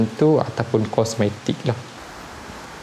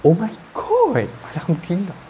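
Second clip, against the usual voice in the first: high, breathless thankful cry; overwhelmed, nearly tearful gratitude; overwhelmed with joy; 6 semitones higher than usual. Real, not acted. Overwhelmed unboxing.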